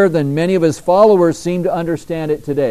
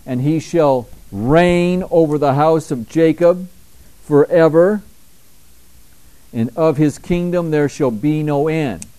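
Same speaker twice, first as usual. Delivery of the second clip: preached scripture; 3 semitones higher than usual. Acted. First reading of verse.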